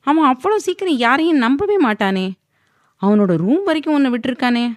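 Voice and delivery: father on the phone quizzing his daughter; father's questioning voice. A narrator's reading. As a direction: press her with question after question.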